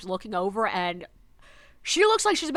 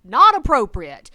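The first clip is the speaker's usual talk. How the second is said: stern scolding; 10 dB louder, slower than usual. Retold or imitated. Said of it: "I'm like".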